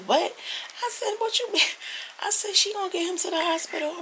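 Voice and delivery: high incredulous retort; shocked reply. Retold or imitated. Refusing husband's request to help the neighbor, high and shocked. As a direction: act stunned by the request.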